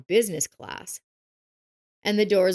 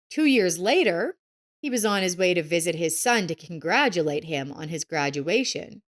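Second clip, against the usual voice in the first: ordinary delivery, nothing marked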